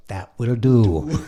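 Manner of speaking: slow, separated words; retold interpreter, drawn out and distinct; clear translation. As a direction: spell out each word slowly for the listener. Blondie translating the mumble clearly.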